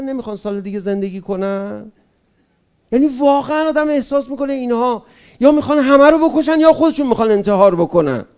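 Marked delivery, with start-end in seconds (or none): 2.9-8.2 heated outburst. Real, not acted. Heated rant about newspapers.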